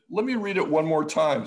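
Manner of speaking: imitating caller rechecking. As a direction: play the caller making a show of checking. Imitating caller checking memo again.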